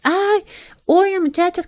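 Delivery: lightly mimicking a creep calling to the girls; creepy stranger imitation. Retelling the stranger calling out to the sisters, mock sugary and high.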